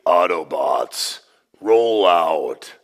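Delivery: deep, slow robot-leader command; very low, drawn-out heroic rallying call; Optimus Prime. Acted. God rallying Elijah to set out, in a deep Optimus Prime voice. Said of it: "what I call my Optimus Prime voice".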